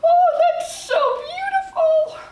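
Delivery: high, slow, choked-up gush over a commercial; teary gushing. Retold or imitated. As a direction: imitate herself welling up with tears at something beautiful. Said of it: "I'm just like".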